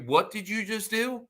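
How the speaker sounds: high, rising disbelief; incredulous. Retold or imitated. Visitor reacts to everyday farm chore, incredulous. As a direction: imitate an amazed grown-up visitor. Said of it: "the excitement that you get from people".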